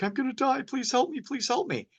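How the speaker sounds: high, pleading imitation of a terrified patient; mock panicked plea. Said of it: "they're like".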